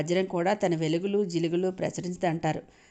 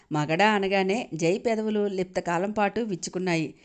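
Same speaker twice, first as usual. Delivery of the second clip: ordinary delivery, nothing marked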